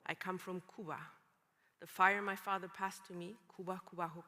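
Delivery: ordinary talk, nothing marked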